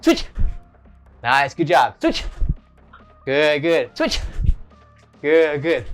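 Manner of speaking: usual speaking voice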